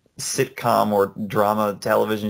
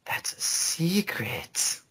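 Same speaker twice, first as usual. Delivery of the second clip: slow, soft whisper; secretive whisper; 6 semitones higher, 8 dB quieter, much slower than usual. Real, not acted. Refusing to name the game, secretive whisper. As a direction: whisper it slowly, keeping a secret.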